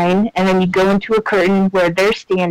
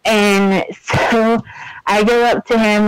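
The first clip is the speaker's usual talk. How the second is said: ordinary delivery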